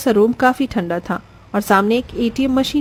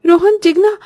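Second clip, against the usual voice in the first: high, louder frightened pleading; panicked pleading; 8 semitones higher, 4 dB louder than usual. Acted. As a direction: beg the children to wake. Mother panics at fainting.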